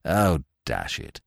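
low, slow, deflated; soft-edged gentlemanly dismay; sad. A narrator's reading. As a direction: let it sink in, mildly crestfallen. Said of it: "he said sadly".

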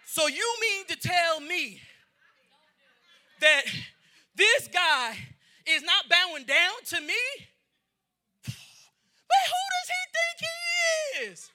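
indignant Haman. Retold or imitated. Preacher playing Haman, offended someone won't bow, outraged cry. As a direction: build from mock disbelief to a shrill outburst. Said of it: "short man's complex".

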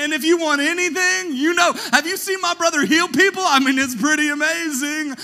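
loud, high, bragging voice; boastful brother. Pretend. Pastor hamming up a bragging sibling, loud and high. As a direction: show off the famous brother loudly.

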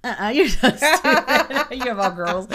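loud, high words through laughter; laughing tease. Real, not acted. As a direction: crack up while scolding a friend playfully. Teasing friend while laughing.

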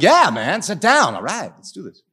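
loud, upbeat buddy call; eager encouragement. Retold or imitated. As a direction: pump him up, high and eager. Casual quote to the pilot.

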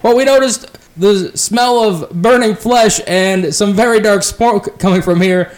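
lightly voiced elf, unconvinced; suspicious elf. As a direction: elf rider doubting the firewood story.

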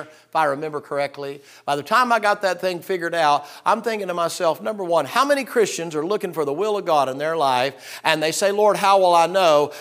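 high, loud sermon delivery; full-force preaching. Acted. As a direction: preach it to the back pew.

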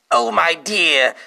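hammed-up lusty voice. Reading erotic fan fiction dialogue.

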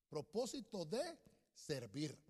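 low, slow, with gaps between words; measured write-it-down delivery; slow dictation. Shown as a demonstration. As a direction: dictate it for people taking notes. Dictating the phrase being written.

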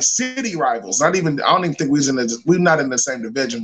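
usual speaking voice

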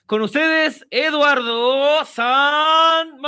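drawn-out showman announcement; grand entrance announcement. Pretend. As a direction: stretch the name like a ring announcer. Host announces co-host. (anuncio de entrada triunfal, alargando el nombre)

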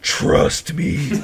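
raspy, voiceless croak; imitated stoned insistence. Buddy urging him to order pineapple, raspy insistent croak. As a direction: croak it out with hardly any voice. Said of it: "He's like".

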